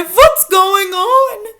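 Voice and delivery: high, loud, drawn-out bewildered cry; theatrical wail of confusion. A narrator's reading. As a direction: call out as a flustered teacher. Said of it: "The teachers cry".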